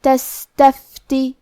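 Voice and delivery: تستفتی slowly with a breathy س; whispered sin. Shown as a demonstration. Teacher demonstrating hams on the sin to students. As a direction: let the س in تستفتی leak air. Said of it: "تو ہم یہ کلمہ کو ایسے پڑھیں گے".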